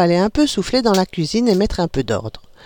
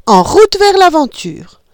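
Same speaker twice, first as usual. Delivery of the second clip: slow, high, loud rallying cry; excited call; 9 semitones higher, 6 dB louder, slower than usual. A narrator's reading. Mother rallying her children to adventure, excited. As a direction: launch the adventure with gusto. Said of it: "elle déclara".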